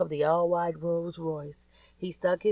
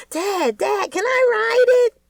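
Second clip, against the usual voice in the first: put-on much higher, louder, begging boy; excited kid; 8 dB louder, slower than usual. A narrator's reading. Junior begging his dad to ride the new dirt bike, excited. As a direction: squeal it like a thrilled boy.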